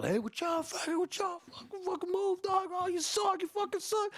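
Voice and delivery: put-on very high-pitched squabbling boy voices; rowdy boys. Young boys pushing each other over a game, high and rowdy. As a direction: imitate kids jeering in a squeaky high voice. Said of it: "we start getting a little loud".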